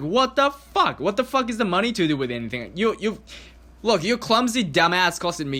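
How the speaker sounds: retold outraged yell at her text; outraged what the fuck. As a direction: blurt it out in high outrage, then rush the rest.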